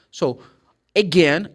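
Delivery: ordinary talk, nothing marked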